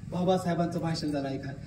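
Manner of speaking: ordinary delivery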